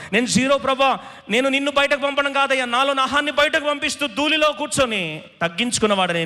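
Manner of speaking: high, impassioned plea to God; fervent pleading prayer. Acted. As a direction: pour it out as Abraham praying. Acts Abraham's humble prayer. (ఆర్తితో గట్టి ప్రార్థన)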